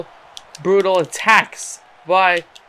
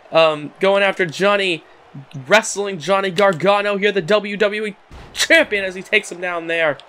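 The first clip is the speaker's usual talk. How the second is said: normal talking voice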